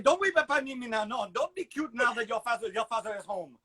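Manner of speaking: put-on high, exasperated French-accented father; mock-scold a too-cute daughter; scolding French dad. Father scolding his daughter for being cute, in a high French accent. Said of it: "Be like".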